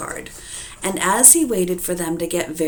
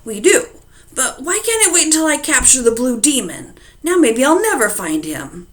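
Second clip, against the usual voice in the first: loud, raised complaint at his wife; grumpy shout; 7 semitones higher, 6 dB louder than usual. Acted. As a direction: grumble loudly about the rain. Farmer complains loudly to wife.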